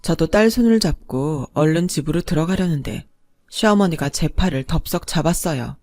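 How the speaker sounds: ordinary delivery, nothing marked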